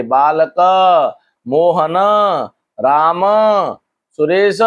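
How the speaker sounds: बालक, मोहन, राम, सुरेश each ending in an audible, held "a"; sounded final vowel. Shown as a demonstration. Teacher giving examples of a-ending words, sounded final vowel. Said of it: "आ का उच्चारण मिले".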